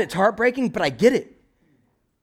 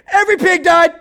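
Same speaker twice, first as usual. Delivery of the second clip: excited report; 13 semitones higher, 8 dB louder than usual. Retold or imitated. Herdsman telling family.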